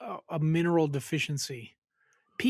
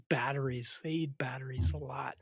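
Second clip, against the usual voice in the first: whispering; 3 semitones lower, 7 dB quieter than usual. Real, not acted. Confiding about kids at work.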